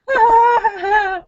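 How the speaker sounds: high, mock-terrified wail; hammed-up jittery cry; wide-awake whimper. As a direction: act out lying awake rattled. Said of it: "Like that".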